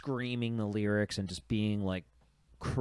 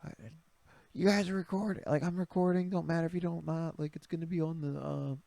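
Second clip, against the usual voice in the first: mock yelling; 6 semitones higher, 3 dB quieter than usual. Restaurant owner filming customers in line, imitated mock yelling. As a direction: imitate her calling out, pitched up. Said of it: "and she's yelling out".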